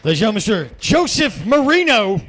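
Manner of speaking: loud, high name announcement; announcer shout. Real, not acted. MC calls out the comic who just finished, loud announcer shout. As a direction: belt out the name to the crowd.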